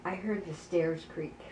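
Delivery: low, slow and very soft; imitating a nervous night-time hush; hushed warning. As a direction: warn her secretly, under your breath. Retold secret warning to sister.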